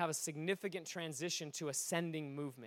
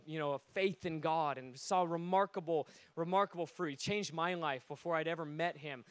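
normal talking voice

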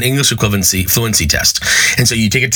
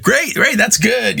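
much higher, chirpy fake delight; forced enthusiasm; 12 semitones higher, slower than usual. Teacher hoping his dream speech landed, cheering much higher. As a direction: cover relief with eager cheer.

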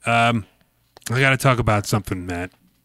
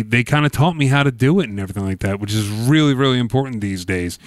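usual speaking voice

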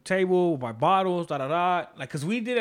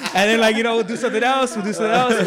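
words pushed out through laughter, higher and louder; laughing while talking; 4 semitones higher, 7 dB louder than usual. Real, not acted. Telling friends about his cousins, still laughing. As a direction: keep laughing as you tell it.

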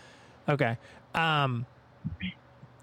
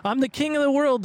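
high, exultant movie quote; mock-triumphant cry, pitched way up; Leonardo DiCaprio; 9 semitones higher than usual. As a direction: proclaim it like a triumphant film hero. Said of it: "to quote Leonardo DiCaprio".

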